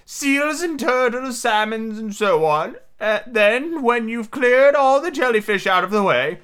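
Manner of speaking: high-pitched, earnest storybook turtle; mock turtle. Mock turtle telling Alice about sea life, earnestly.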